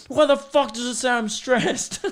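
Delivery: high-pitched mock yell through laughter; retold yelling. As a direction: act out yelling at the watch, half laughing. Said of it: "I just start yelling at it".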